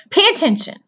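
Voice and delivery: high, loud urgent yell; screaming. Acted. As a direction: shout at him to listen. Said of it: "screamed Wilbur".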